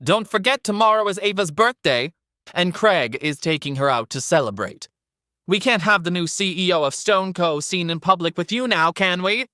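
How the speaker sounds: high, shrill taunting woman. Acted. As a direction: needle him in a shrill voice. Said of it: "Sandra's shrill voice".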